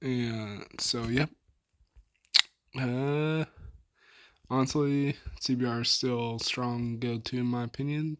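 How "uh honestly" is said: ordinary talk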